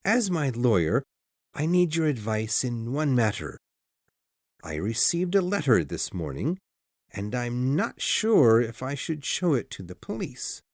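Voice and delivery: calm down and ask for advice; calmer voice. A narrator's reading. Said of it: "continued in a calmer voice".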